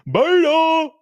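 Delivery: high, loud comic cry; Jesse Lee Peterson. Acted. Streamer imitating a favorite personality's catchword, high loud call. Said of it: "God, I love Jesse Lee Peterson".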